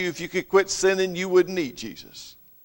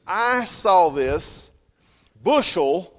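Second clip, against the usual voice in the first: ordinary talk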